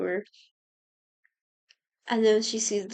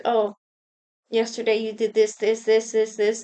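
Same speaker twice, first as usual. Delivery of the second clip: louder, rattling off each this to unnerve them; mock intimidating taunt; 4 dB louder than usual. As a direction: list their sins back at them, gloating. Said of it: "to intimidate them, he'll just be like".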